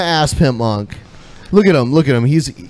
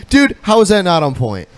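ordinary talk

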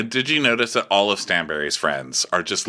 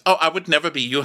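imitating Chanel; 8 semitones higher, faster than usual. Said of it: "And she goes".

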